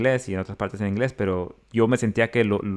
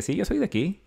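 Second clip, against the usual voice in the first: higher, quieter bluff; bluffing claim; 9 semitones higher, 4 dB quieter than usual. Retold or imitated. Narrator bluffing to the taco girl, higher and softer. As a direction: fake confidence, trying to fool her. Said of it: "le dije".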